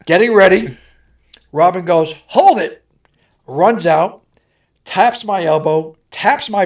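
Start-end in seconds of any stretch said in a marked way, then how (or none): 2.3-2.8 sharp call. Retold or imitated. Director halting the stunt on set.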